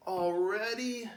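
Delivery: drawn-out, slow sleepy whine; slow, sleepy complaint; sleepy sigh. Acted. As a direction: groan it like you just woke up. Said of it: "sighs a sleepy-eyed Jason".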